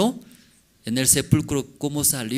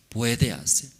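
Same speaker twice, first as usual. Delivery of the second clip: normal talking voice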